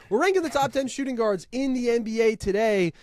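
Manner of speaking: big podcast opener voice; announcer intro. Acted. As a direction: kick off the show like a radio host. Restarting the show intro.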